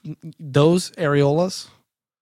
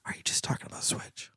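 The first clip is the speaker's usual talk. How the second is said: hushed, very soft whisper; 14 dB quieter than usual. Pretend. Podcaster checking with co-host off the topic, in a whisper. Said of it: "can the audience hear me whisper".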